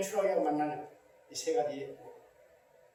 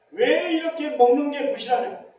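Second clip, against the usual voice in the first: grumbling complaint; 6 semitones higher, 7 dB louder than usual. Acted. Preacher voicing grumbling Israelites.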